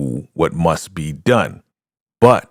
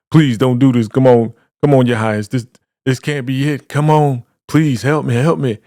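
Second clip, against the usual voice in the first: higher, louder mock begging; pleading; 6 semitones higher, 4 dB louder than usual. Condemned man asking his leader for help, urgent mock pleading. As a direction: beg urgently in a raised voice.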